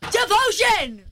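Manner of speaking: high-pitched scream of a song title. Real, not acted. Radio host announcing an all-caps song title, screamed. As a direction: scream it out, high, away from the mic. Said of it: "I'm gonna scream it over here".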